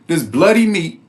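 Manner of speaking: normal talking voice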